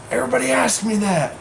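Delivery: high, loud mock outburst; really mad. Irate woman answering a man about driving home, mock angry yell. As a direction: imitate an irate bar patron's yell. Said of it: "Just really mad".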